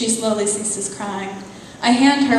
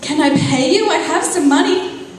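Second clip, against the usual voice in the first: higher and louder, pleading; woman pleads with Death to take her money, higher and louder; desperate plea; 6 semitones higher, 5 dB louder than usual. Acted. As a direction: beg and bargain anxiously.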